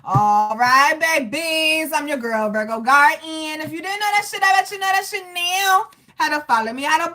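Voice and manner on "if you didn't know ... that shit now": higher, sassy brag; cocky sign-off brag; boastful sass. Real, not acted. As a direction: show off your name proudly. Brags about her name.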